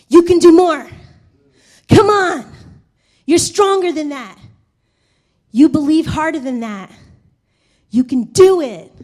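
loud pushing encouragement; urging mentor. Retold or imitated. Mentor pushing a believer, loud and firm. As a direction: push them hard with belief. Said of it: "someone that will say".

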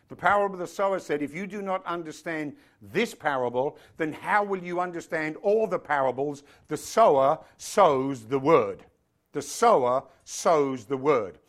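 forceful, emphatic sermon delivery; preaching. Real, not acted. Preacher telling congregation faith comes from hearing God, emphatic preaching. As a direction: preach it, leaning hard on key words.

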